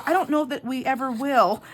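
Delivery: imitated earnest apology, high and loud; sincere high-pitched clerk voice; so serious. Young clerk missing the joke entirely, so serious. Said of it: "and he was so serious".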